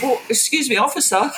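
politely flag down the policeman; mock meek politeness. Calling back departing cops, mock meek politeness. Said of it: "And I said".

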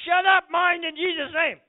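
loud, high preacher's rebuke; forceful shouted command. Shown as a demonstration. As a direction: order it away at full volume. Demonstrates commanding one's own mind.

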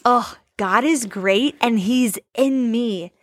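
normal talking voice

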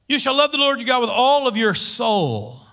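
scripture recitation. Acted.

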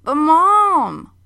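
high, loud cry of protest; a son protesting to his mom, high and loud; protesting child. Acted. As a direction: wail it like a kid who's been punished unfairly. Boy protests being sent away.

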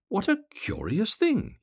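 very high, slow exclamation; astonished cry. A narrator's reading. Swallow hit by a water drop, high astonished cry. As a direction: marvel aloud, stretching it out in puzzlement. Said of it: "he cried".

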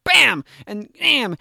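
loud, high, drawn-out bam sounds; imitated blows. Retelling repeated cleaver hits, punchy imitated impact. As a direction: punch out each slow "bam" like a blow.

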